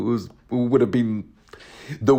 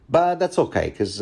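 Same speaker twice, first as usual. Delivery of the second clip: ordinary delivery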